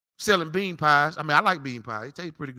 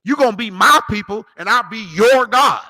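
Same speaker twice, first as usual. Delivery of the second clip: "my" and "your" punched loud; loud declaration leaning on "my" and "your"; stress on MY, YOUR; 2 semitones higher, 7 dB louder than usual. Shown as a demonstration. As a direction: hammer the possessives "my" and "your", stretching the end. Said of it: "That's possessive pronouns he's using".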